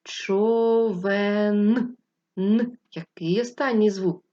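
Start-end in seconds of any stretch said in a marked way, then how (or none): 0.0-2.0 Човен drawn out with the Н held at the end; slow sound-out, listening for the last sound; word sounded out. Shown as a demonstration. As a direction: stretch Човен and let the final Н ring. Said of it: "давайте ще раз промовимо і прислухаємось".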